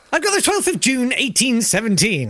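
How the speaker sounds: grand, high announcing tone; pompous. Acted. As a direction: declare the date like a pompous herald. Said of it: "God, I sound like the most pompous".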